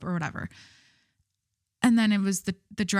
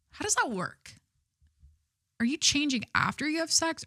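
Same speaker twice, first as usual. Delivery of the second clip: baffled questioning; 7 semitones higher than usual. Retold or imitated. Podcaster wondering about PJ girls with boyfriends, baffled. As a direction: puzzle it out in a higher voice.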